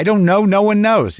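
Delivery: high-pitched helpless protest; exasperated answer. Acted. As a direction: throw up your hands in exasperation. Acted answer to worried question.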